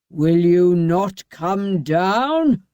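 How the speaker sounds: very slow, drawn-out threat; slow menacing bawl. A narrator's reading. Bluebeard bawling at his wife. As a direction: lean on every word. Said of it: "bawled Bluebeard".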